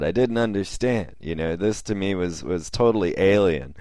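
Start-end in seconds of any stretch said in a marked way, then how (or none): none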